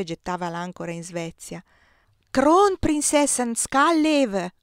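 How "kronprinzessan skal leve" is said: high, loud, slow cheer; crowd's rhythmic shout. A narrator's reading. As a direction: call out a Swedish cheer in drawn-out beats. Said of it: "si gridava tra la nebbia".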